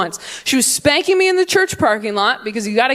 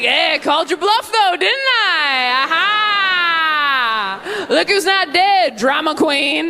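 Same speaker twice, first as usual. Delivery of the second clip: higher, slow mocking gloat; gloating dad; 7 semitones higher, much slower than usual. Dad teasing his daughter, higher smug gloating. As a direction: lord it over her, drawing it out. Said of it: "He's like".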